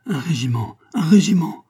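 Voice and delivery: building to a loud, high shout; crying out. A narrator's reading. Lafayette calls for a regiment, crying out. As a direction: call out urgently, second cry much stronger. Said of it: "cria Lafayette".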